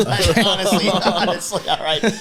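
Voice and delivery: high, loud laughing protest. Real, not acted. Husband defending himself about the swearing, laughing. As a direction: protest through your laughter.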